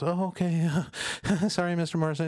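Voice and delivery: high nervous voice with a laugh; high embarrassed waitress giving in; flustered giggling waitress. Retold or imitated. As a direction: giggle through the apology.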